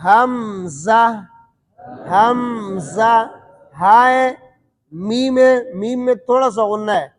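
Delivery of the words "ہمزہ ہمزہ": ہمزہ drawn out very slowly, the ha kept audible; ہمزہ stretched, with voice and breath flowing through the ha; slow careful hamza. Shown as a demonstration. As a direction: sound out ہمزہ slowly, letter by letter. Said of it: "صحیح ہے؟".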